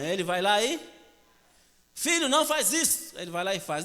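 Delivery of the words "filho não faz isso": high-pitched exasperated mock scolding; exasperated mother. Mother's son keeps misbehaving, exasperated scolding. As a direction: plead sharply, voice pushed right up.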